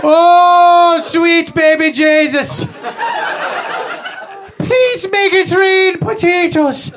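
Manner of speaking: high, wailing voice of a starving Irishman; starving famine victim. Acted. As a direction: moan it out like you're dying of hunger. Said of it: "like a little play".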